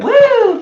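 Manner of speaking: high, loud "woo"; quick high-pitched cheer; celebratory whoop. Real, not acted. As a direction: cheer a tiny win with a whoop. Celebrates not drinking the toner.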